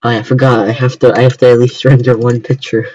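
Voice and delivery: ordinary delivery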